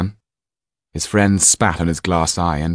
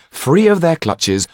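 plain reading voice

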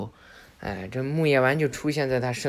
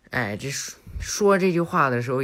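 ordinary delivery, nothing marked

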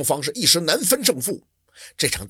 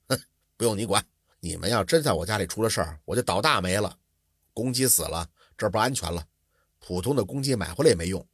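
cold sneer; 7 semitones lower, 4 dB quieter than usual. A narrator's reading. Scholar coldly refuses the maid's comfort, a lower sneering voice. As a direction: lower voice, a scornful snort then curt talk. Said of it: "刘墨林冷笑着说".

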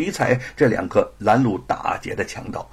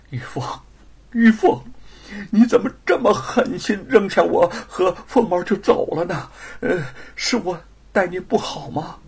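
tearful; 6 semitones higher than usual. A narrator's reading. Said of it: "眼泪嗖的那家伙就涌出来了".

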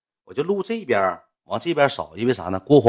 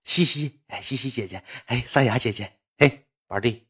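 mock toast with a grin; 2 semitones higher, 7 dB quieter than usual. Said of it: "跟国皇喝酒笑脸".

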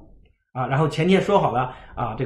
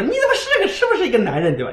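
mock scolding; 11 semitones higher, faster than usual. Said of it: "他都会骂说".

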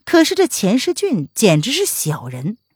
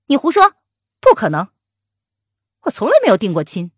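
resentful outburst; 2 semitones higher, 4 dB louder, faster than usual. Acted. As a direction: hurl the denial back at him. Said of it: "一脸怨恨地说". (满怀怨恨地高声反驳)

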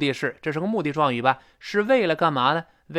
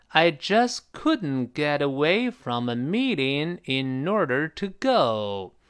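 slow, evenly stretched sentence for repeating; slow model reading; slower than usual. Shown as a demonstration. Teacher leading students through a hard sentence, slowly. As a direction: read it slowly so learners can follow. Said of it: "慢慢地跟老师再过一遍".

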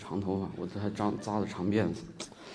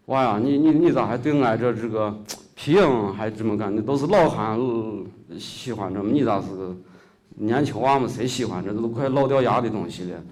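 put-on old puppeteer; 3 semitones higher, 7 dB louder than usual. Puppet master marvels that a young man loves shadow puppets, loud dialect. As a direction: exclaim like an old master surprised a youngster cares.

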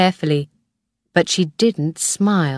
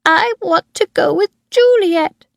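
put-on high, louder whiny little boy on the edge of tears; crying child; 13 semitones higher, 4 dB louder than usual. A narrator's reading. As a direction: plead like a boy about to cry. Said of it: "Tim began to cry".

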